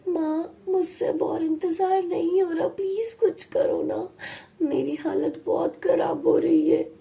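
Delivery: high, tearful begging; pained girl whining in desperation; desperate plea. Acted. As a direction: beg mother as if near tears. Girl desperate, begs her mother.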